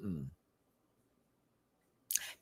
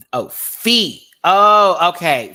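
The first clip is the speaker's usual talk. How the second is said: much louder, higher exclamation; sudden realization; 6 semitones higher, 14 dB louder, much slower than usual. Real, not acted. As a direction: blurt it as the penny drops. Realizing the pronunciation.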